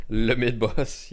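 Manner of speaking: put-on slow French accent. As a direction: say it the French way, slowly. French joke phrase on stream.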